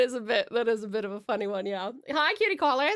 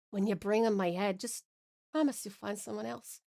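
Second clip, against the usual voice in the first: softly resigned; tender last request; 4 semitones lower, 7 dB quieter than usual. Acted. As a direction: plead gently, as a farewell. Dying lover's last request.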